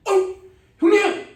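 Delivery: very high, loud bellowed greeting of tough underlings, with a laugh; hammed-up gangster shout of respect; gang greeting. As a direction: hail the big boss across the street. Thugs greet their old boss.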